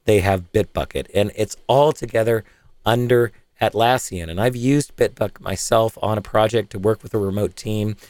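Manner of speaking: upbeat sponsor read. Acted. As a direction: read it like a podcast ad. Host reads sponsor ad.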